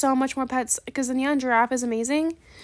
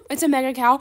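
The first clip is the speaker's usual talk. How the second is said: loud, excited reveal; overjoyed exclamation; 7 dB louder than usual. Pretend. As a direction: call out the prize in delight. Overjoyed at pets in trade offer.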